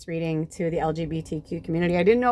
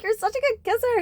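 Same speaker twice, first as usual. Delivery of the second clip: gushing friend. Retold or imitated. Friend stops after kissing her, gushing. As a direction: imitate a thrilled friend, high-pitched.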